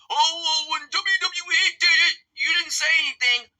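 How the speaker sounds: high, whiny mock voice; mocking whiny critic. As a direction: whine it like a smug critic. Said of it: "the sickos".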